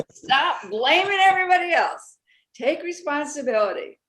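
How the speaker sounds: repeating it gleefully on request; laughing, emphatic repeat. Real, not acted. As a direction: laugh while you hammer it home. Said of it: "Say that one more time, [name]".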